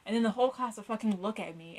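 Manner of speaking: ordinary talk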